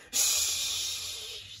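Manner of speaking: long sharp "shh"; hushing. A narrator's reading. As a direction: shush a friend while hiding. Possum hushes Skunk while hiding.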